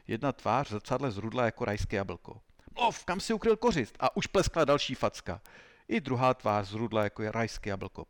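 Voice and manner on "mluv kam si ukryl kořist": high, forceful interrogator's order; angry demand. Acted. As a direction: demand an answer between slaps. Interrogator slapping the suspect.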